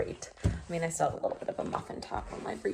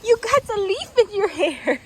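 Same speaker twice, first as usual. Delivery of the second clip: laughing; 11 semitones higher, 10 dB louder than usual. Real, not acted. Repeats to horse, laughing.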